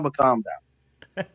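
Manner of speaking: slow, soft calm down, drawn out; slow soothing. Acted. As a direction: ease him down gently and slowly. Host soothing an excited caller.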